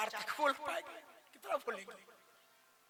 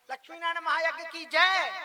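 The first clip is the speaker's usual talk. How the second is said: raised, high ceremonial cheer; rallying victory cry; 4 semitones higher, 6 dB louder than usual. Retold or imitated. Priest closing the blessing before the feast, rallying cry. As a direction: call it out like leading a crowd's jai. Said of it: "जय बोल गई".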